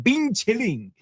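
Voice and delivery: high, laughing mock "Bing Chilling"; imitating John Cena. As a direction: squeal "Bing Chilling" like a fan. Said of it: "He was like".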